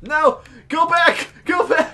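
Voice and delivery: high, loud panicked yells; urgent shouting. Real, not acted. Player yells as ball nears goal, urgent shout. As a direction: shout frantic orders.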